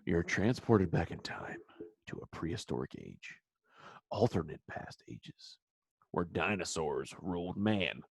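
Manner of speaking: low hushed scenario narration; dramatic narrator. Acted. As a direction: set the scene like a movie trailer. Dramatic scenario narration, slow quiet.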